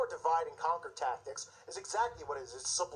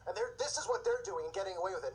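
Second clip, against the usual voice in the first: normal talking voice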